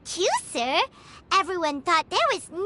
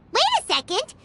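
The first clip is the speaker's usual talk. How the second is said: a little higher, catching yourself mid-thought; sudden realization; 5 semitones higher than usual. Acted. The companion realizing the trick mid-sentence, surprised. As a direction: stop short as it dawns on you.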